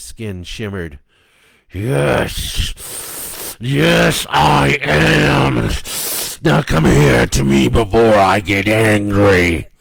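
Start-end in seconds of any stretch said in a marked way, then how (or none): 1.7-9.7 loud rising threat; menacing threat. Acted. The mother thing threatening Stephanie, getting louder. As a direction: threaten the child with growing anger.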